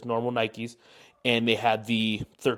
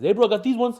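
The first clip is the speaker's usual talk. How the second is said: upbeat salesman voice; 11 semitones higher, 4 dB louder than usual. Retold or imitated.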